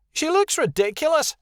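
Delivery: high, scornful outburst; high-pitched snarling character voice; snarled. A narrator's reading. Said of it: "Rebecca snarled".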